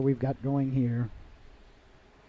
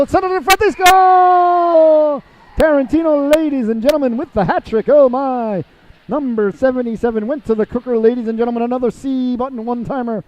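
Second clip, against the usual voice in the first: building to a much higher, louder roar on the goal; excited goal call; 7 semitones higher, 8 dB louder than usual. Real, not acted. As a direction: scream the goal as it goes in, then ride the high. Commentator calling a goal live.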